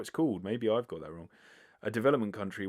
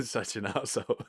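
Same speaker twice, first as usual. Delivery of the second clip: laughing; 4 semitones higher, 4 dB louder than usual. Real, not acted. Laughs at own joke.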